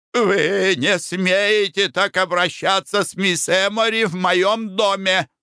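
high, indignant outburst; angry shout. A narrator's reading. Baronet shouts at Foker in his own house, high. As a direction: thunder at the offender. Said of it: "закричал баронет".

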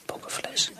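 soft voiceless mutter; whispered. Acted. Anna searching for her bottle, whispered. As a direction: hiss the word to yourself.

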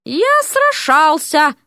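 slow, puffed-up boast; proud boast. Acted. Prince proudly explaining his escape, slow puffed-up boast. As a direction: puff up your chest. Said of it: "приосанился принц".

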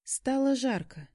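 plain reading voice

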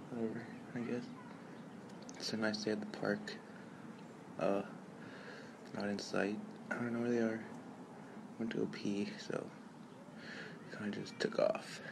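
hushed voice, half whispered; whispering. Real, not acted. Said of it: "if they hear me whispering".

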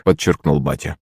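plain reading voice

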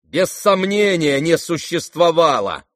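high, emphatic verdict; curt confirmation. Acted. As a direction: cut in to settle it firmly. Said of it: "отрезал Ганя".

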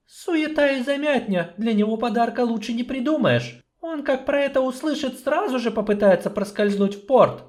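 high, measured female voice; doubtful sorceress. Acted. Sorceress doubting the dwarf's plan, high and measured.

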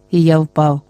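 plain reading voice

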